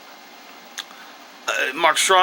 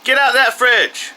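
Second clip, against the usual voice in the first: slower, higher shouted order; stern yell; 8 semitones higher, 4 dB louder than usual. Real, not acted. Parent repeating fridge order to kid, stern yell. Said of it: "gotta go yell at this kid".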